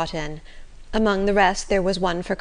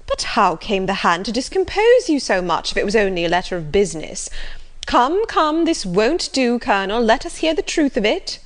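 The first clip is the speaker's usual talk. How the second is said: lively, raised busybody voice; insistent Mrs Jennings; 5 semitones higher, 4 dB louder than usual. Acted. Mrs Jennings demanding the truth from the Colonel, insistent. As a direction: badger him for the truth, pitch and volume jumping.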